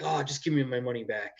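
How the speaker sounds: ordinary delivery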